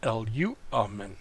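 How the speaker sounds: L U amen with the U stressed; accent on U. Shown as a demonstration. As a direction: lift the U in L U amen. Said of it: "that's how it would be accented".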